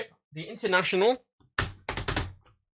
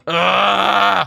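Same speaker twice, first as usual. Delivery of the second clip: loud exasperated ugh; frustrated groan; 4 semitones higher, 9 dB louder than usual. Real, not acted. Vlogger fighting webcam settings, loud frustrated ugh. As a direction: let the frustration burst out.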